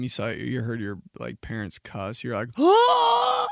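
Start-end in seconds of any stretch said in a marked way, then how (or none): 2.6-3.5 high, loud shocked yelp; a sudden startled cry, as if scandalised; shocked gasp. Retold or imitated. Kid hearing his parents swear, shocked yelp.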